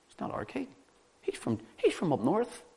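high, hushed objection; mock protest. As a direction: protest softly in a lifted voice, as if imitating objectors. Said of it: "why they're crying this out".

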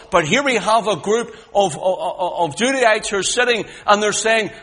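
loud, high, rising preacher's cry; full-force preaching. Acted. Preacher crying God's love to the congregation, full force. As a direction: preach it out to the whole hall.

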